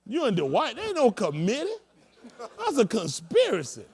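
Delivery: loud, high teasing from the pulpit; mock accusation. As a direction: call them out in mock outrage from the pulpit. Preaching joke.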